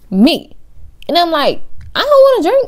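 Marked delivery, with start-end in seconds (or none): 1.9-2.7 squeaky high, loud refusal; high protest. Retold or imitated. Refusing the boy's shots, high protest. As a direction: shoot the pitch way up in protest.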